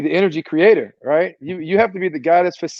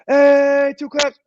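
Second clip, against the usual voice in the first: coach's clap call; 10 semitones higher, much slower than usual. Shown as a demonstration. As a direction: bark the two-clap cue at a hundred players. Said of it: "So I'm gonna go".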